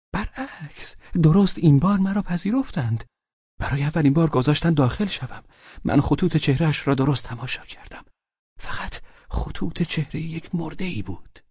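a flustered husband spilling news; agitated Pavel voice. Acted.

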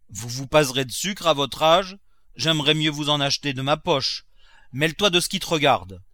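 lightly acted servant-master spat; quarrelling Nanon and Grandet.

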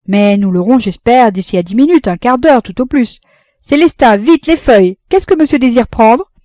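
loud, lively and high café keeper; busy café owner. Acted. Café keeper reassures a customer and orders the waitress, loud and brisk. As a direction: call out briskly to staff and customer.

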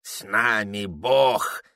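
put-on gummy, slow old man mumble; toothless mumble. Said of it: "прошамкал Вахромеюшка".

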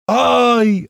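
loud, high, drawn-out whoop; joyful yell. A narrator's reading. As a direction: let out a high yell of joy. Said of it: "орал Страйк".

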